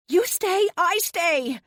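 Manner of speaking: high desperate yell of refusal; defiant shout. A narrator's reading. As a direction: shout it back at him, refusing to leave. Refusing to leave Luke in battle.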